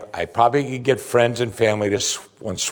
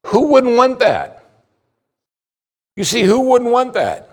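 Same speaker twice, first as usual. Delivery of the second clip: much higher, ringing appeal to the room; emphatic rhetorical question; 11 semitones higher, 4 dB louder than usual. Real, not acted. Preacher challenging listeners about joy on bad days, emphatic. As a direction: pitch it way up as an insistent challenge.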